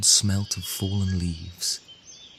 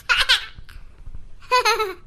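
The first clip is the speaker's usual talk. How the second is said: put-on high, bright baby giggle; a newborn bursting into laughter; baby's laugh. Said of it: "then started to laugh".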